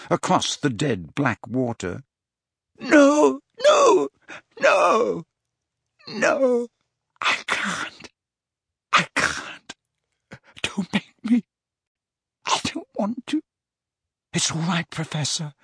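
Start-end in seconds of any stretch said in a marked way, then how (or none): 2.8-6.7 high wailing cries, loud at first; tormented high wail; anguished screaming. A narrator's reading. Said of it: "Dumbledore screamed". 7.2-13.4 weak, broken whimper; spent, anguished begging; tormented pleading. A narrator's reading. Dumbledore in torment begs to stop. 14.3-15.5 soft, anxious soothing; hushed, worried comfort; gentle reassurance. A narrator's reading. First, softer take of reassurance.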